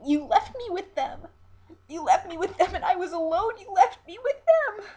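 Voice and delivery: high, loud screaming through sobs, growing wilder; sobbing and screaming. Acted. Siren clinging to the captain's legs, screaming through sobs. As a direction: clutch his legs and scream it out, crying. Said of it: "sobbing and screaming".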